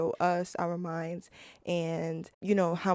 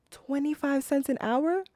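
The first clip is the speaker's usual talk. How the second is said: high-pitched, disbelieving question; scandalized echo, pitched way up; incredulous; 8 semitones higher, 3 dB louder than usual. Acted. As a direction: repeat it in disbelief, rising sharply at the end. Outraged reaction to the figure.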